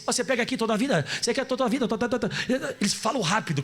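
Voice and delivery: ordinary talk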